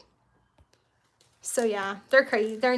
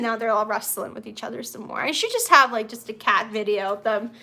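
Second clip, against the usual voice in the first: ordinary delivery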